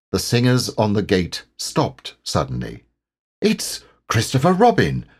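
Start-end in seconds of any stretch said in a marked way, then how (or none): 3.4-5.1 eager, high-pitched exclamation; delighted to spot a friend; Pooh. A narrator's reading. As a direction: pipe up eagerly. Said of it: "said Pooh eagerly".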